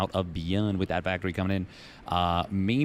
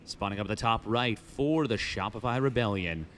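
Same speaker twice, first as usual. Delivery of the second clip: usual speaking voice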